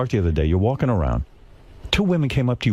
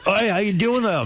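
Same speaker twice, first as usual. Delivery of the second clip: mock Scottish accent; 8 semitones higher than usual.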